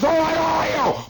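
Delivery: high-pitched cop yell with a bang; cop shouting. Retold or imitated. Cop firing into the air, high shout. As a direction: bark like a panicked cop. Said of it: "He says".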